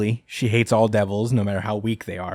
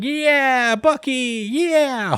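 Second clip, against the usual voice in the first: much higher, louder happy cheer; cheering kids; 3 dB louder, much slower than usual. Retold or imitated. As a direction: cheer like a whole class celebrating. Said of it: "everybody's like".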